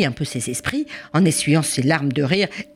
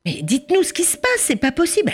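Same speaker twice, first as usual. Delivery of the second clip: higher desperate plea; pleading family; 12 semitones higher than usual. Acted. Family begging the police for an explanation, higher plea. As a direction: beg to know what's going on.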